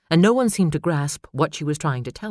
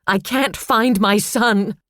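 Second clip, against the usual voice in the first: high, loud, slow desperate scream; mother screaming for her lost son; 6 semitones higher, 5 dB louder, much slower than usual. A narrator's reading. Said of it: "screaming out".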